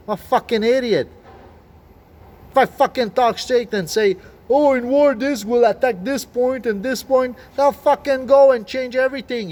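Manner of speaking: high, loud, agitated outburst; heated rant. Real, not acted. Vlogger answering a critic who questioned him, hotly agitated. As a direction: argue back hotly, voice pushed up.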